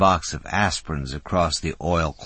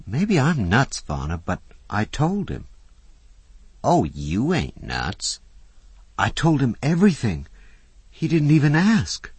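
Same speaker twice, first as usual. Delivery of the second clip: put-on high, breathless eager young woman; a young woman blurting out her secret; young Susie, eager; 9 semitones higher, 4 dB louder than usual. A narrator's reading. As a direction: spill it out higher and louder, all in a rush.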